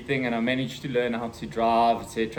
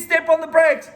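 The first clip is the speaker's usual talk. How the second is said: high, louder urgent command; imitating mom's urgent order; 13 semitones higher, 6 dB louder than usual. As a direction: bark the order to brake. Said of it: "And she says".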